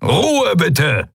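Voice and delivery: high-pitched, commanding cry; roared command. A narrator's reading. Admiral raises his prosthetic arm for silence, roaring. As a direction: demand silence with full authority. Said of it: "brüllte der Skria-Admiral".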